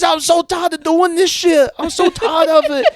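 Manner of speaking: put-on high, loud, crying screech of a kid; screaming mimic. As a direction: wail it like a furious kid fed up with chores.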